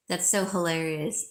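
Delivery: ordinary talk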